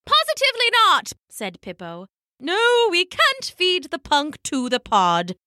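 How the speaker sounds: put-on high, firm old penguin hen; shutting the idea down; stern elder penguin. Elder female penguin firmly refusing Popo's plan.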